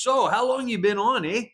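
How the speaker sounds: hammed-up Canadian agent asking; Canadian accent. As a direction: play the chatty Canadian border agent. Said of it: "he goes".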